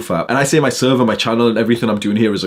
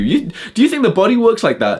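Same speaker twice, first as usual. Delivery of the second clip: incredulous rant; 11 semitones higher than usual. Real, not acted.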